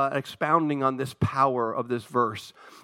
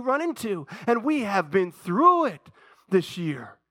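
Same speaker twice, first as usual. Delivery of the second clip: rising preaching; 5 semitones higher than usual. Acted. Preacher builds to a climax.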